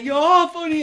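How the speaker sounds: very high, loud imitated wail; hammed-up tearful squeal; girlfriend mock crying. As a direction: imitate a partner blubbing reassurance. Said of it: "And she's crying".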